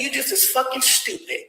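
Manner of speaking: usual speaking voice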